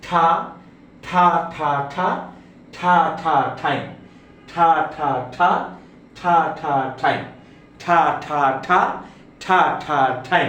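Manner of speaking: ঠা, ঠা repeated with a hard breathy t; each ঠা with a strong burst of air; drilling aspirated t. Shown as a demonstration. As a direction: chant ঠা over and over, ending on ঠাই. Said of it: "সেটা কিরকম হবে".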